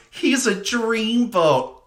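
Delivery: put-on high, slow, dreamy sigh of a smitten girl; swooning Lorraine. Lorraine swooning over the boy she fancies, high and slow. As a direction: draw it out like a lovestruck teen. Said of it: "Lorraine turns around and goes".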